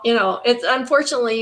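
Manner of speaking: ordinary talk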